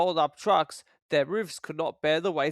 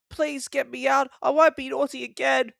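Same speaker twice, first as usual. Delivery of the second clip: pleading Thomas; 9 semitones higher, 4 dB louder than usual. A narrator's reading. Thomas begs.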